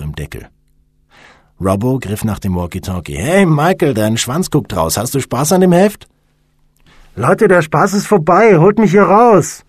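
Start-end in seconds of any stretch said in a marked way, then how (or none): 3.2-6.1 loud, high mocking call over the radio; raised, taunting voice; teasing call. A narrator's reading. Prankster teases trapped friend by radio. 7.2-9.6 protesting shout. A narrator's reading. Trapped man demands release from coffin.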